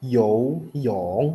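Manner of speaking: YOU falls only, then YONG; falling YOU before YONG; half third tone. Shown as a demonstration. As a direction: say YOU dropping without a lift back. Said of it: "yang depannya tuh cuman turun doang".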